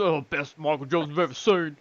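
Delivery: mock imitation of a friend's line; lightly put-on voice, poking fun at him; mimicking friend. Teasing a friend over his fight rant, mimicking him. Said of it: "The way, the way you said that".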